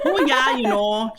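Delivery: hammed-up Minnesotan vowels; Minnesotan accent. Shown as a demonstration. Guest playing up home state for host, Minnesotan accent. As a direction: lean into a folksy Minnesotan accent. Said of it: "talk to me in a Minnesotan accent".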